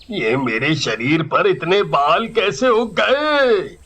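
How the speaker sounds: ordinary delivery, nothing marked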